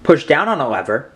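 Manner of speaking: normal talking voice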